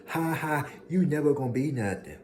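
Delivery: high, loud sneering laugh-taunt; mocking taunt. Imitating the haters who laughed, high sneering taunt. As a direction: sneer it like an old bully. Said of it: "But y'all remember".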